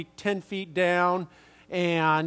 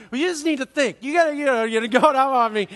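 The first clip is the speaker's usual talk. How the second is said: much higher and louder, indignant scolding; indignant lecture, higher and louder, speeding up at the end; scolding stranger; 6 semitones higher, 4 dB louder, faster than usual. Retold or imitated. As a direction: scold them like a busy man put out. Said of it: "he was angry".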